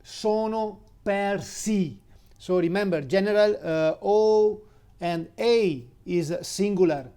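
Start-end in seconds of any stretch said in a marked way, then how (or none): 0.0-2.0 "Sono persi" slowly, a bit higher, careful Italian; slow Italian demo. Shown as a demonstration. Teacher slowly models the Italian plural for a student. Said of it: "They are lost".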